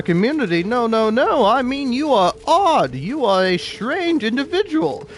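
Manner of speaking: pompous, scandalized company boss; hammed-up capitalist bit. Shown as a demonstration. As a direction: scold the worker with theatrical outrage. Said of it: "doing as extreme of a funny l-, little bit as that".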